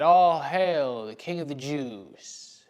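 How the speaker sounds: loud, drawn-out mock proclamation; mocking acclamation. Soldiers march the beaten prisoner around, mocking hail. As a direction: hail him slowly and loudly, dripping with mockery. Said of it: "mockingly said".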